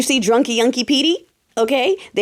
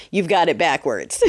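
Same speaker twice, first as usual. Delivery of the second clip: ordinary talk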